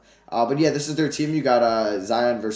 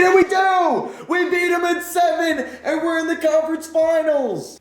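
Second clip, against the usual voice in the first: big high yell of triumph, much louder; excited shout; 4 dB louder than usual. Real, not acted. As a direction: scream it like you just won the series. Series won in game seven.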